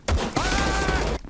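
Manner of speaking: sharp fright cry; sudden short scream; high startled yelp. Real, not acted. Startled in game.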